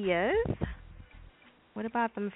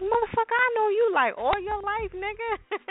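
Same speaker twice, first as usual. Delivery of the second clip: much higher mock voice, offended; indignant friend; 3 dB louder than usual. Old friend calling out a friend who acts funny, high mock indignation. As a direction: squeak it out like an outraged old friend. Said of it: "He's like".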